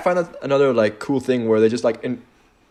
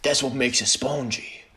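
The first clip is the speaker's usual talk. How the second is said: put-on French accent; 6 dB quieter than usual. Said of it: "That was a terrible French accent".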